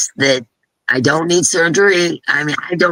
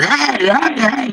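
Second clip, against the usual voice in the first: mock yell; 9 semitones higher, slower than usual. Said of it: "He's yelling at her".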